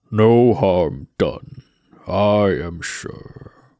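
slow hollow Death voice; grave, unhurried skeleton voice; talking skull. Acted. The skull speaks.

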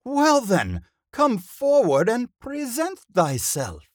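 high, loud, grand declamation; theatrical old thespian. A narrator's reading. Old actor questions budding helper, grandly theatrical. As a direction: declaim as if to the back row.

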